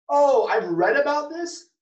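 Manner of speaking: high, loud and slow, stunned; a jolt of high, loud disbelief; stunned realization. Retold or imitated. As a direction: gasp it out in shock. Retold shock after hit-and-run.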